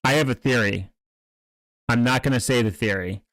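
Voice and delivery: ordinary talk, nothing marked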